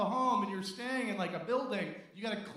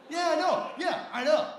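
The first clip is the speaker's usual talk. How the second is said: loud admission; 6 semitones higher, 7 dB louder than usual. Real, not acted. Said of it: "responding to audience reaction".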